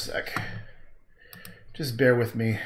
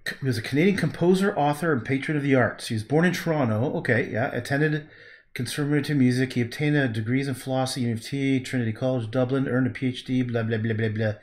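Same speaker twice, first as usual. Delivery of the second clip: normal talking voice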